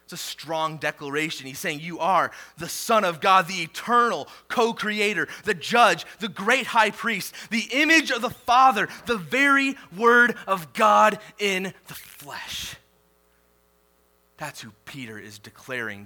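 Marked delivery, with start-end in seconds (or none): 1.8-12.8 louder and higher, swelling into a sung proclamation; preaching at full force. Acted. Pastor proclaiming Jesus's titles to the congregation, preaching at full force. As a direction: lift it like a chanted creed.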